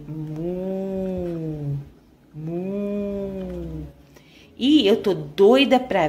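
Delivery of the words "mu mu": playful mooing; cow moo. Acted. Cow puppet moos.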